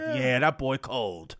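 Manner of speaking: impressed comic mutters his verdict, slow and low; Tracy Morgan impression. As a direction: lay it back like a laid-back celebrity. Voicing Tracy Morgan in story.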